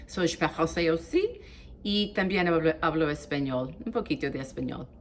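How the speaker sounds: je parle français aussi in French, then Spanish, trailing off quickly. Shown as a demonstration. Showing viewers her languages, casual French then Spanish. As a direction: switch languages with easy pride. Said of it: "taking grade twelve French".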